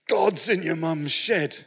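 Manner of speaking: a shaken carpet fitter's urgent news; shaken urgency. Retold or imitated. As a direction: lean in and say it slowly.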